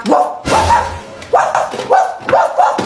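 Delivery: playful woof woof; mock barking. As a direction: bark like a dog to prove it. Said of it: "Marunong din naman ako tumahol".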